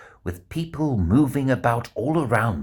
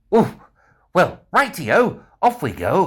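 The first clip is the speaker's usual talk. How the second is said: higher and louder, cheerfully setting off; 6 semitones higher, 5 dB louder than usual. Acted. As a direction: off we go with a spring in the step. Bear cheerfully sets off.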